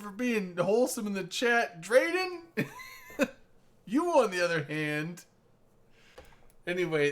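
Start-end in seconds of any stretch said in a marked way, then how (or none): 1.8-5.2 teasing call-out. Acted. Calling out a chatter teasingly.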